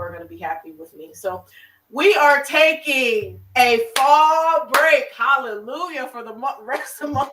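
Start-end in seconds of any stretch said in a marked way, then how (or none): 1.9-7.3 loud, high, drawn-out celebratory proclamation; jubilant announcement. Real, not acted. Host telling viewers about an October break, jubilant. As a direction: stretch it out and rejoice.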